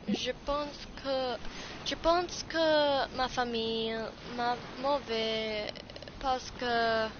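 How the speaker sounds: slow, drawn-out French recitation; careful learner's French, slowly; practising French. Real, not acted. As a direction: recite the French word by word, holding the endings. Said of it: "trying to speak French".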